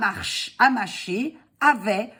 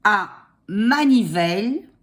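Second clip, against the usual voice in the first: dictating; slower than usual. Shown as a demonstration. Dictating slowly.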